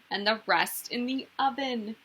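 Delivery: plain reading voice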